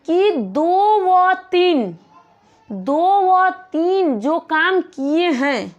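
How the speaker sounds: "दो व तीन" drawn out very slowly and pressed; slow emphasis. Shown as a demonstration. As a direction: spell out "दो व तीन" word by word, slowly. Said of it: "teacher stresses articles two, three". ("दो व तीन" को बहुत धीरे और ज़ोर देकर)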